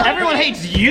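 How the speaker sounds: laughing tease. Real, not acted. Teasing, laughing echo.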